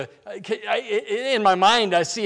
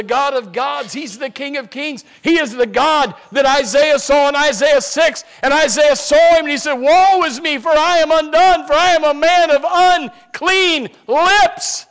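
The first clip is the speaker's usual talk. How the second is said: loud, higher, proclaiming Isaiah; full-force preaching; 9 semitones higher, 10 dB louder than usual. Acted. Preacher proclaiming Isaiah's vision to the church, loud full-force preaching. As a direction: thunder it from the pulpit.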